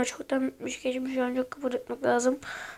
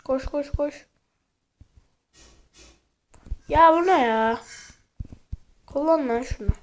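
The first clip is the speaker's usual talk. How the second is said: being chased; 5 semitones higher than usual. Real, not acted. Fleeing enemies in game.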